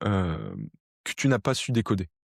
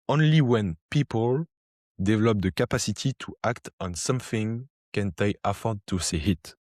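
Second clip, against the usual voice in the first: French-accented English. Shown as a demonstration. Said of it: "je vais la dire en anglais / Mon accent anglais est terrible". (anglais avec un fort accent français, début lent)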